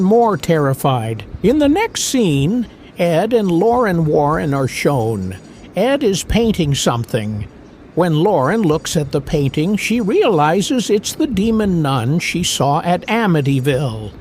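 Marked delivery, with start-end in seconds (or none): none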